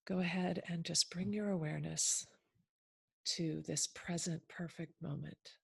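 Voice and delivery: measured phrases with long, settling pauses; unhurried meditation leader, softening as it goes; guided meditation. Acted. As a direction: ease listeners gently into the present moment. Leads listeners into a guided meditation.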